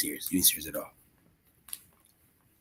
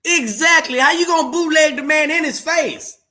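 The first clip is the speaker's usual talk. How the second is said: much higher, louder mocking indignation; indignant; 3 dB louder than usual. Real, not acted. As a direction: sneer at the bootlegger with disbelief. Refuses to highlight the copycat.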